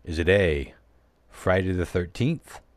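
normal talking voice

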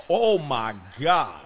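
loud, slow drawn-out gasp of shock; shocked oh my God. Real, not acted. Near interception by his quarterback.

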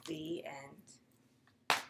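whispered ending; hushed whisper so the sleeper stays asleep. Shown as a demonstration. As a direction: close the book in a hush. Story ends, child asleep.

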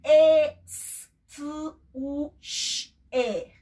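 "e, s, t, u, sh, e" with separated sounds; segmented letter sounds; sounded out. Shown as a demonstration. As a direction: spell each sound apart. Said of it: "buscando un".

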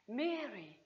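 a whispered-soft Mary, lingering; hushed tender name; soft, quiet calling of Mary. Acted. He speaks her name, said twice.

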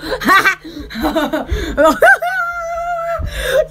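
long laughing burst. Real, not acted.

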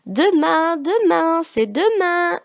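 little brother shouting eagerly; child yelling. A narrator's reading. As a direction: yell it out like an excited little boy. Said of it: "hurlait le petit nid de koala".

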